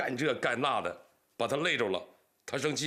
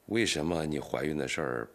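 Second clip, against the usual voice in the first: usual speaking voice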